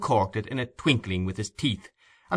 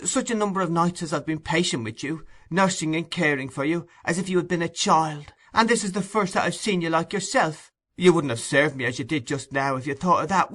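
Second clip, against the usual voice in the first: sweet tender tone; 7 semitones higher than usual. A narrator's reading. Nancy coaxes Sykes with sweet tender pleading. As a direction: coax him sweetly. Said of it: "sweetness of tone even to her voice".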